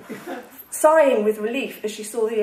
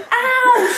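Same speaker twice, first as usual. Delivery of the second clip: high, loud yelp of pain; screamed ow; 11 semitones higher, 6 dB louder than usual. A narrator's reading. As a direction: cry out high in acted agony. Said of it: "she screamed".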